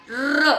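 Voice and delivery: र as a long rolled "rrr"; vowelless r. Shown as a demonstration. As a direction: trill र on the tongue tip. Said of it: "तो ये बन गया र".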